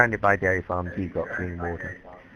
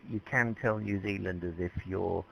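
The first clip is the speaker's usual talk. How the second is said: ordinary delivery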